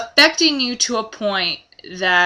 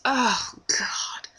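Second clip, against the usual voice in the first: whispered, dismayed oh God; imitated disgusted whisper; 9 dB quieter than usual. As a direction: breathe it out in mock dismay. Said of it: "and she just goes".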